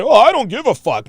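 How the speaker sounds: high, loud mock tough talk; mock bravado. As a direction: imitate a blustering show of not caring. Mocks men who claim indifference.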